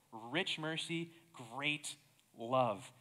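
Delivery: "Rich" and "great" slowed and set apart; emphatic stress. Real, not acted. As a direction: let "Rich mercy, great love" land piece by piece. Repeats the key words from the verse.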